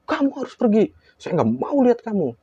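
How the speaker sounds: high-pitched, agitated rejection; acted outburst. Possessed woman rejects the teller, imitated hysterical outburst. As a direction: imitate a hysterical banishing cry. Acted confrontation, ordered to leave.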